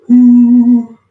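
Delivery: long, held high-pitched hoot; drawn-out falsetto whoop; loud high ooh. Pretend. Reaction to a favorite song.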